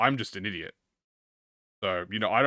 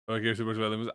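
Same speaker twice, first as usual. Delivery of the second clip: ordinary talk, nothing marked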